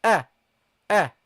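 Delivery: a said short and light, like a fatha. Shown as a demonstration. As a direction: start on a clean, light a. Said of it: "we read it as".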